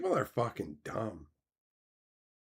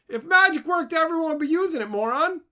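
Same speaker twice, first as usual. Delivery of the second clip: high, loud, fed-up scolding; exasperated outburst; 7 dB louder, faster than usual. Real, not acted. Scolding magic believers, high and loud. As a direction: shout it at someone who believes in magic.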